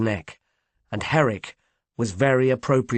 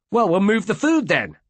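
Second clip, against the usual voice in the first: raised, higher shout; 8 semitones higher than usual. A narrator's reading. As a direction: call it out with sudden energy. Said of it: "shouted Nigel".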